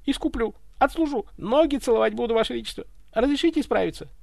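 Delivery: high-pitched servile pleading; frantic begging. Acted. Butler promises to kiss the tsar's feet, frantic begging. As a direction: grovel and plead for another chance. Butler begs on his knees.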